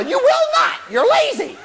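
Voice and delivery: high, scolding little voice; jeering voice in the head; nagging inner voice. Acted. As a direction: rant in a high mocking pitch. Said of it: "everybody's got this little voice".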